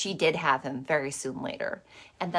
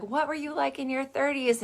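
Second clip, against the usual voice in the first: high, eager questioner voice; audience question; 7 semitones higher than usual. Retold or imitated. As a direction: ask it up high like an eager fan. Audience question, retold on stage.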